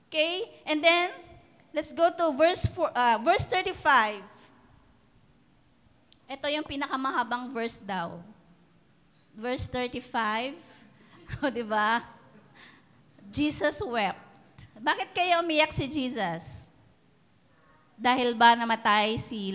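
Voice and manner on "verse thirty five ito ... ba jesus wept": usual speaking voice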